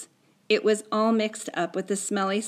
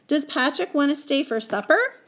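lightly voiced mother, higher pitch; mother's question; 7 semitones higher, 3 dB louder than usual. Mother asking about the friend staying, light inquiry. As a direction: ask as a mother, a little raised. Mother asks her son.

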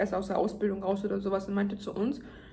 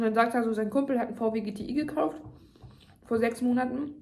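talking between bites; slightly full mouth; chewing while talking. Real, not acted. Seen 3: Bite taken before; lips closed and jaw working between words, fork at mouth.

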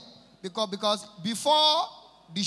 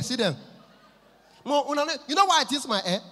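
ordinary talk

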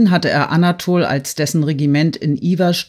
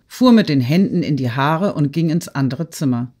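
ordinary delivery, nothing marked

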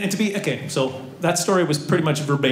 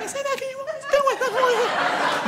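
very high, feeble pretend yell; arguing wife's yell; much faster than usual. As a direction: argue back in a tiny high squeal. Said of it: "the neighbors think I'm yelling at myself".

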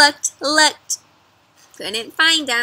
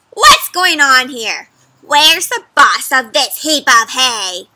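loud, slow demanding witch; bossy witch; 8 dB louder than usual. Acted. Witch demanding to see the farmer.

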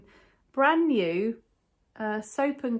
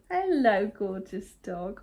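greeting the dog; slower than usual. Real, not acted.

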